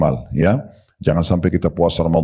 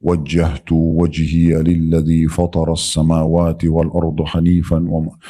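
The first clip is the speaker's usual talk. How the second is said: slow, lower Arabic recitation; 3 semitones lower, slower than usual. Shown as a demonstration. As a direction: recite the opening prayer slowly.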